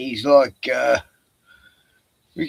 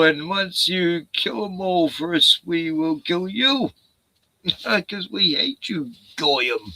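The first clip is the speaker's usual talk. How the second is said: mock villain plea; 7 semitones higher than usual. Mock plea voiced for the elites.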